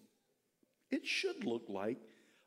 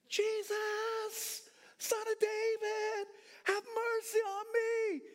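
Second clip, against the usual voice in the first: high, drawn-out shout; crying out; much slower than usual. Acted. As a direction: call desperately to someone passing in a crowd. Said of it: "he just continued to cry".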